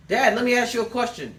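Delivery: put-on high, loud pleading kid asking dad; kid's voice. Kid pestering his dad with a question, high and loud. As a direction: play the eager child nagging. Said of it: "My children".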